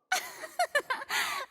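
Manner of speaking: a high, showy little laugh; high gleeful laugh. Acted. Furina laughs at the Traveler before the duel, gleeful laugh. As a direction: laugh as if victory is certain.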